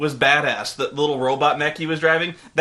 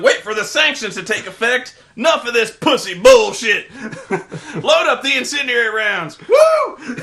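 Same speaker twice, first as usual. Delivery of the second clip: higher, louder barked commands; hammed-up colonel orders; 6 semitones higher, 5 dB louder than usual. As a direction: bark it like a gung-ho Marine. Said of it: "It's like".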